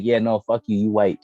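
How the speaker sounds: ordinary delivery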